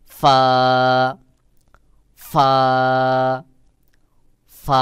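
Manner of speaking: loud, held Arabic ফা with a blown f; airy ফা. Shown as a demonstration. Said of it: "বাহিরের দিকে বাতাস বের করে".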